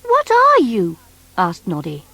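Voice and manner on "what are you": put-on high, bright little toy boy; Noddy's voice. Noddy asking the strange creature, high boyish voice. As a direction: pitch up as young Noddy.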